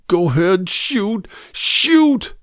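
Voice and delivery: plaintive cry that rises with each word; rising plaintive plea. A narrator's reading. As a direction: voice climbing very high, begging. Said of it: "His voice rose plaintively".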